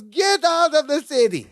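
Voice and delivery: high-pitched mock amazement; amazed Balki. Balki hears Larry is a prophet too, amazed. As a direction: exclaim it high, in disbelief.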